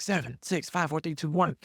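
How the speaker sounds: barked stagehand countdown; crew countdown. Retold or imitated. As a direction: count down and hurry them on. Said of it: "They're like".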